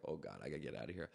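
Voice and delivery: quick, hushed panic under the breath; rushing the words, wanting to bolt; panicked mutter. Retold or imitated. To himself, seeing Secret Service watching, quick hushed panic.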